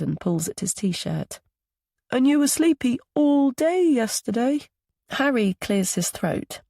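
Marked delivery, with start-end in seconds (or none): 2.1-4.6 put-on high child character voice; small boy's high voice. A narrator's reading. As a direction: speak up like a little boy. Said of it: "Milo's high voice".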